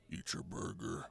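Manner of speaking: unvoiced, low whisper; Grimace whispering. Grimace whispering to the parent, soft low whisper. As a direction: breathe it as a secret. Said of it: "He's like".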